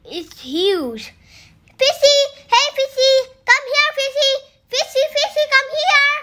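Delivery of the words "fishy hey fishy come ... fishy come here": calling out. Real, not acted. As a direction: call the fish over eagerly. Calling the giant fish.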